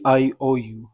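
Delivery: slow, drawn-out I owe you; slow example phrase. Shown as a demonstration. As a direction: say it slowly for the learners. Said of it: "اگر میں کہوں کہ".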